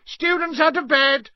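high, loud bellow. A narrator's reading. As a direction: bellow it down the hall. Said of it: "Peeves bellowed".

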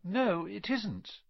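pensive, unhurried disagreement; thoughtful. A narrator's reading. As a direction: weigh it up gently as you speak. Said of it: "said Harry, thoughtfully".

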